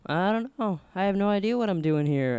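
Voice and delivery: high-pitched retold protest of not knowing; helpless cluelessness. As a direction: reenact being at a loss, voice up high. Retold helpless answer to schoolmates.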